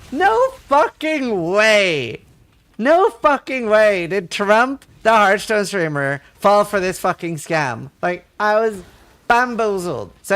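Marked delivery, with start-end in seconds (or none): 0.1-7.9 loud, drawn-out incredulous exclamation; retold disbelief. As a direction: stretch each word in stunned mock disbelief, easing off. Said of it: "I could not believe".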